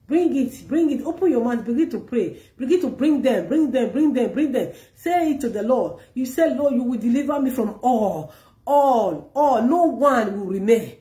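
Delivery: high, loud urgent call to pray; fervent preaching. Acted. Preacher urging the congregation to pray aloud, fervent. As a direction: urge them on, rising and fervent.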